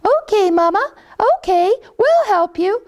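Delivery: very high, eager little chick; baby chick voice. Acted. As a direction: squeak it up high like a tiny chick. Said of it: "And her little baby said".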